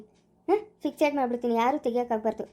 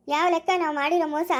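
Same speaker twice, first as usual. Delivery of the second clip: ordinary talk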